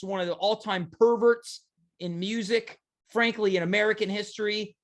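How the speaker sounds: ordinary talk, nothing marked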